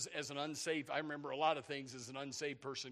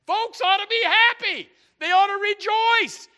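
very high, very loud exhortation; full-force pulpit cry, last word stretched; preacher's shout; 20 dB louder than usual. Real, not acted. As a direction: shout it out to the whole congregation.

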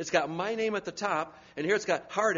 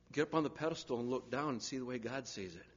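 usual speaking voice

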